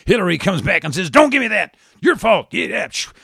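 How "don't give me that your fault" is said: high, loud screamed blame; screaming. Acted. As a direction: scream it right back at her. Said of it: "she's screaming now".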